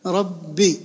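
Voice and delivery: ربي split as rab-bi; doubled ba. Shown as a demonstration. Teacher showing still then voweled B, rab-bi for ربي. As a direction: stop on the B of ربي, then release. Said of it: "الباء الأولى ساكنة".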